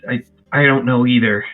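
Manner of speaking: ordinary delivery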